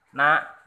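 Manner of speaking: na said loud and clear, dropping the a; loud demo word. Shown as a demonstration. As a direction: belt out na as a teaching example. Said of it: "cách đọc là". (đọc to rõ chữ na)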